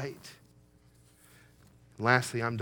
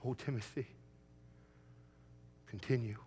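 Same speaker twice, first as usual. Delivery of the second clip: tender plea; 11 dB quieter than usual. Acted. Tender plea after the shouting.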